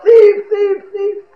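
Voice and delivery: high, loud cry of alarm. Acted. Householder running out after a thief, crying out. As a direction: shout it high to raise the whole neighborhood. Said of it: "the household is crying out".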